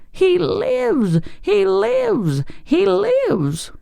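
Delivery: very high, repeated witchy squeals; rapturous. A narrator's reading. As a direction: shriek it over and over in rapture. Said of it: "she repeated rapturously".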